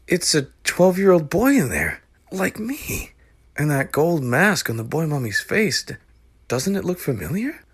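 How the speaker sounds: put-on high excited boy; young boy voice. Boy character voice.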